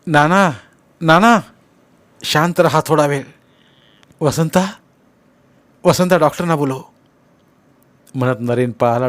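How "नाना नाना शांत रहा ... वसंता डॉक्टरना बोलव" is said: high, loud, urgent calls; worried son raising his voice; alarmed. A narrator's reading. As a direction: call out in mounting worry for help. Son alarmed as father collapses.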